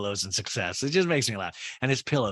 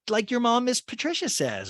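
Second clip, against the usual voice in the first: much higher, sugary mock voice; imitating Olivia; 12 semitones higher than usual. Host mimics Olivia needling Whitney, high catty voice. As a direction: pitch way up for a catty jab. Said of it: "And Olivia's like".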